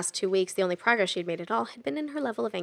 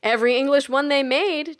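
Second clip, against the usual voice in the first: louder, proud and beaming; 6 semitones higher, 7 dB louder than usual. Acted. Jack proudly boasting to Kelly, louder and beaming. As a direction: boast with a grin. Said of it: "Jack beamed".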